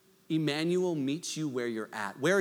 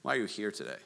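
exasperated sigh; 9 semitones lower, 7 dB quieter than usual. Retold or imitated. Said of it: "is like".

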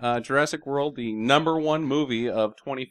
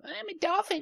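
very high, soft dolphin voice; 3 dB quieter than usual. Retold or imitated. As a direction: chirp it like a dolphin. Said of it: "Her dolphin dinosaur sound".

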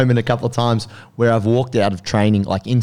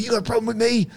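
higher-pitched, challenging street tough; aggressive junkie; 11 semitones higher, 3 dB quieter, faster than usual. Retold or imitated. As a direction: square up to a stranger. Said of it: "He's like".

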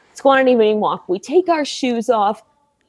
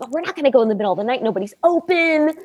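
fast dismissive rattle; much faster than usual. Retold or imitated. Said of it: "I was like".

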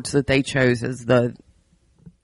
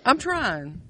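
much higher, lighter repeat; pleading insistence; 8 semitones higher, 4 dB quieter than usual. Real, not acted. Explaining the name to a confused friend, pleading insistence. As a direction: plead it high, half-joking. Same words repeated in mock plea.

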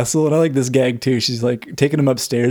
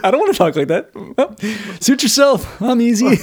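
exaggerated cartoon intonation; 9 semitones higher than usual. S1 voices Igor.

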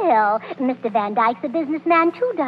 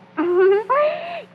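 pleased giggle; 8 semitones higher than usual. Acted. Dulcie pleased with her matchmaking.

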